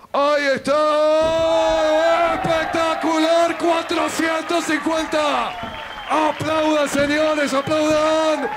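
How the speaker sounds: yelling hype at a big move; excited shout. Acted. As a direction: roar it out to the crowd. Big move, crowd urged.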